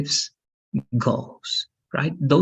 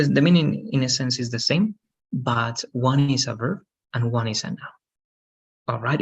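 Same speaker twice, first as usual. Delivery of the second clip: ordinary talk, nothing marked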